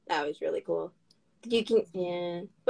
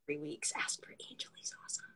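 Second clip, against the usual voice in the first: very soft whispered tip; whispered aside; 19 dB quieter than usual. Real, not acted. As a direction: whisper the name like a secret tip. Whispered plug aside to listeners.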